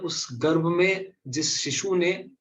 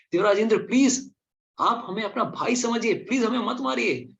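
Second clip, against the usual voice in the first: pleading babies; 7 semitones higher than usual. Acted. Babies begging Indra to spare them.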